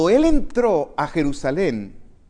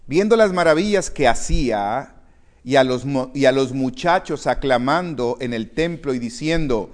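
rising, louder preaching read; 2 semitones lower, 4 dB louder than usual. Acted. Preacher reading Matthew to the congregation, rising louder. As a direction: press the gospel reading on the congregation.

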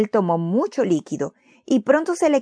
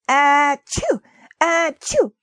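two loud high sneezes, the second even higher; sneezing; 7 semitones higher, 4 dB louder, much slower than usual. Acted. Sick Timoteo sneezes at the dinner table, loud high sneezes. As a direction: sneeze twice, hard and high. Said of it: "Él estornudó otra vez".

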